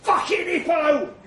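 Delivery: high, very loud strained retort; grunted shout. A narrator's reading. Narrator wrestling Trev into a headlock, grunting back loudly. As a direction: shout it back mid-struggle. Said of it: "I grunt back".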